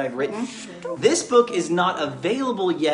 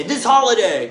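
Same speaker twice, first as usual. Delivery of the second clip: higher and louder, with real conviction; like you mean it; 6 semitones higher, 8 dB louder than usual. Shown as a demonstration. Dad showing his child how to say it with feeling. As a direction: announce it with full enthusiasm. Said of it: "I'll say it like you mean it".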